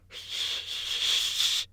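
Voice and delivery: voiceless creature shush; hiss. Acted. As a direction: hush him with a snake hiss. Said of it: "said Gollum".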